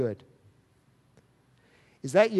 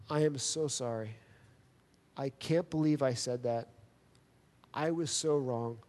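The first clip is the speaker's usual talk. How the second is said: humble apology; 4 semitones lower, 6 dB quieter than usual. Retold or imitated. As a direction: lower and much softer, sincere sorrow.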